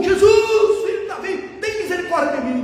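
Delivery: high, loud cry for mercy; shouted plea. Shown as a demonstration. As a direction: bellow it like a blind beggar pleading. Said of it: "Não, ele gritou".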